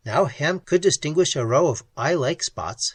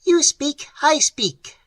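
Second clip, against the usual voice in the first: much higher, shrill creature voice; shrill clicking; 13 semitones higher, 6 dB louder than usual. Acted. Creature correcting Pat, shrill clicking voice. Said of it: "suggested the creature".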